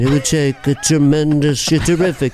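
gushing president doling out praise; mumbly New York presidential impression; Trump. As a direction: pour on presidential superlatives. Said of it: "Make me Trump".

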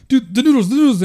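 high, loud imitation of a frantic friend; mock freak-out. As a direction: freak out over a kitchen emergency, hammed up. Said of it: "you don't have to freak out".

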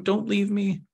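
small, high-pitched plea; pleading child. Acted. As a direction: beg in a little girl's high voice. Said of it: "the energy going".

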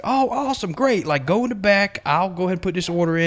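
chirpy pleased boss; cheery boss. Retold or imitated.

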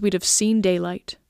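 ordinary delivery, nothing marked

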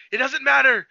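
mock a villain brushing off a plea; defiant villain. Acted villain brushing off her plea.

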